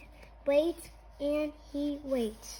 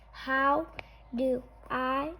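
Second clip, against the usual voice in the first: plain reading voice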